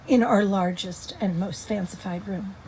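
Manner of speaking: ordinary talk, nothing marked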